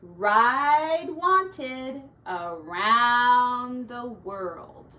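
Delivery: drawn out very slowly, word by word; slowly spelling out a notice; slow sign reading. Acted. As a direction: read the sign slowly like a banner.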